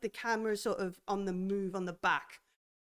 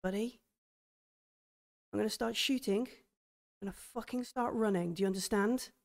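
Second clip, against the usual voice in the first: softer, tense warning; tense hush; 6 dB quieter than usual. Acted. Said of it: "Darla goes".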